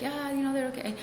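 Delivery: casual, softly spoken imitation; nonchalant. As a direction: brush it off lightly and quietly. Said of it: "the person telling it was all nonchalantly like".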